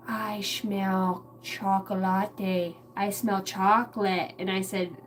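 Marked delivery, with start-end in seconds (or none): 0.1-2.8 very slow, stretched-out sniffing discovery; toddler daughter. Retold or imitated. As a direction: draw out CHOCOLATE syllable by syllable like a small child. Imitates daughter, then repeats usual way.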